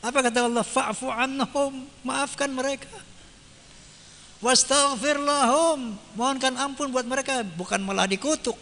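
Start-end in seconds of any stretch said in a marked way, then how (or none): 0.6-1.9 slow chanted Arabic verse; Quranic recitation. Acted. Preacher quotes the verse to his audience, slow chanted recitation. 4.4-6.0 slow chanted Arabic verse; Quranic recitation. Acted. Preacher quotes the verse to his audience, slow chanted recitation.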